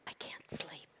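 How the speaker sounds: tiny breath-only plea; exhausted whisper. Acted. Sleepless old woman at rabbi's door, exhausted whisper. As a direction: whisper it, utterly spent.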